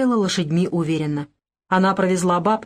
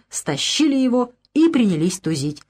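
ordinary delivery, nothing marked

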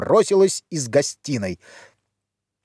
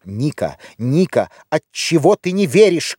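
plain reading voice

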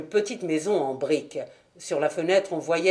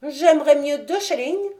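put-on much higher childlike voice; a little boy asking for money; small boy; 8 semitones higher, 4 dB louder than usual. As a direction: pipe up like a greedy six-year-old. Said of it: "répondit le petit phénomène".

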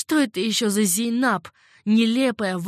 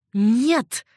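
loud, higher cry of horror; horrified cry; 4 semitones higher, 8 dB louder than usual. A narrator's reading. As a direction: recoil at the very thought. Риган пришла в ужас. (испуганный громкий вскрик)

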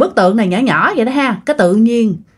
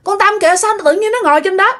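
high-pitched alarmed call upward; startled calling; 12 semitones higher, faster than usual. Retold or imitated. Mother spots friend atop banyan tree, startled calling. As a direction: imitate spotting a friend up high and urging her down. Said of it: "mẹ mới kêu".

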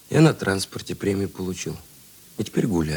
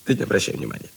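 usual speaking voice

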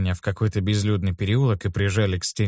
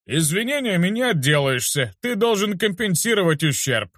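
put-on higher, drawn-out smug teen knight; teen bully posing as a knight, smug and drawn out; smug drawl; 7 semitones higher than usual. A narrator's reading. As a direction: drag the words out, pleased with himself. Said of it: "протянул утёс".

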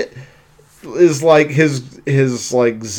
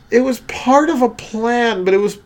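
Michaela impression; 7 semitones higher, faster than usual. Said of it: "Almost Mickey Mouse".

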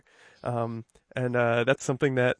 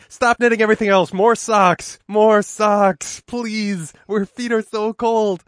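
loud, high, begging call; pleading shout; 6 semitones higher, 5 dB louder, slower than usual. Retold or imitated. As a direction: plead loudly for more socks. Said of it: "they just were like".

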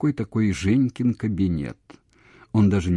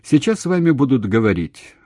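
slightly too loud, raised pitch; loud announcement; 5 semitones higher, 6 dB louder than usual. A narrator's reading. Telling the caller someone will speak, loud. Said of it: "сказала громко, громче, чем следовало".

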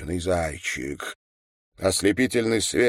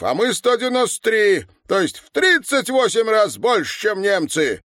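high, loud oratorical outburst; worked-up high-pitched tirade; indignant harangue; 12 semitones higher, 4 dB louder than usual. A narrator's reading. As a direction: rail at your listeners in a raised voice. Old man harangues women about tanks.

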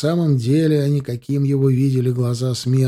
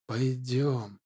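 soft, hushed beckoning; quiet luring call; 9 dB quieter than usual. Acted. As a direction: lure him in quietly. Spirit luring him softly. (тихий манящий зов)

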